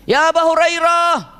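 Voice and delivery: high, drawn-out calling yell; acted mother yelling for her son; mother's shout. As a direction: call your son from far away, slow and high. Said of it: "Ibunya neriak kan?".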